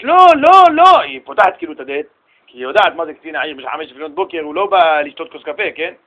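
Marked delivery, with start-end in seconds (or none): 0.0-1.0 loud anguished no, no, no; mother screaming no in a nightmare; retold scream. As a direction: cry out in terror at the door. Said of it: "והיא צועקת".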